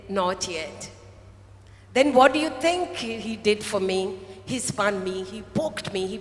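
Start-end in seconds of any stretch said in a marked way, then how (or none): 0.1-0.9 low, gentle refusal. Retold or imitated. The master gently answering the clay, calm and low. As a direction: say it softly low and kindly. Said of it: "gently said".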